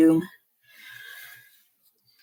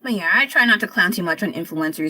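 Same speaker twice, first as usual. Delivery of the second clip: ordinary delivery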